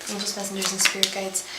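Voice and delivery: very soft, level prayer chant; hushed ritual recitation under the breath; murmured invocation. Real, not acted. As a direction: murmur it as a repeated incantation. Repeated invocation while shuffling cards.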